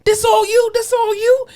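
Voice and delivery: hammed-up high voice for the worker; imitating the dump worker. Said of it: "And he's like".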